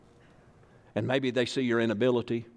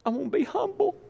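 mock humble listener. Preacher mimics a listener too modest to say it, in a high whine. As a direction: whimper it like a falsely modest churchgoer. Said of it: "You say".

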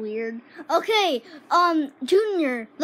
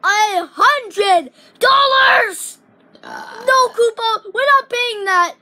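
loud, very slow, stretched disbelief; shocked outcry; 4 semitones higher, 7 dB louder, slower than usual. Acted. Kid hears the duck's price, loud, slow and outraged.

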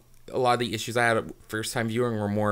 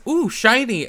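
high, delighted "ooh, shiny"; gushing over a shiny toy; mock dazzled fan; 11 semitones higher, slower than usual. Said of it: "he thinks".

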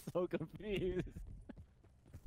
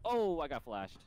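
normal talking voice

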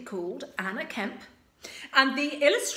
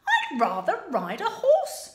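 high, loud and quick; eager princess, higher and louder; impatient Sue; 6 semitones higher, 6 dB louder than usual. Acted. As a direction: hurry him along. Sue speaking.

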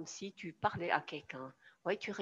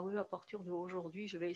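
soft, half-hearted resolve; undecided; 4 dB quieter than usual. Shown as a demonstration. Coach showing how slumped posture spoils a decision, half-hearted. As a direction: say it without any conviction. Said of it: "C'est pas une décision".

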